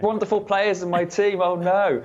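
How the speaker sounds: mock a colleague moaning about too many stars; teasing parody of a whiny pundit; mock whining impression. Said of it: "Was that an impression of me?".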